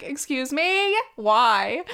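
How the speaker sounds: incredulous, as if she misheard him; a girlfriend balking at her boyfriend's plan to wax, in loud disbelief; shocked disbelief. Retold or imitated. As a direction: pitch jumps up on Excuse me, then a loud sharp Why.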